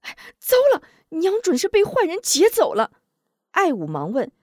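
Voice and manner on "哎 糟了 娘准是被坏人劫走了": high, louder panicked cry; a sudden jolt of panic; alarmed. A narrator's reading. Wu Zhong realizing his mother was kidnapped, high panicked cry. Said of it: "急得一跺脚".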